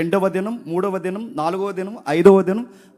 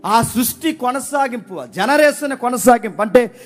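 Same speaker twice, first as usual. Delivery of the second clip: raised, high preaching voice; forceful preaching; 8 semitones higher, 7 dB louder than usual. Real, not acted. Preacher asking the congregation who continues creation, forceful high preaching. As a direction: build the argument insistently toward a question.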